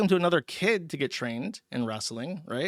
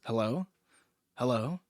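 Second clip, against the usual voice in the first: deadpan monotone; 3 semitones lower, 4 dB quieter than usual. Retold or imitated. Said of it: "in this really deadpan voice".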